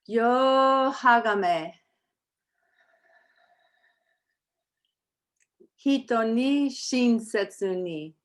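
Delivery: each Japanese principle spoken slowly with long pauses; slow recitation. Shown as a demonstration. Teacher leading the class in the Japanese Gokai, slow and solemn. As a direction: chant each line deliberately for the class to repeat. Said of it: "We're gonna say them slowly".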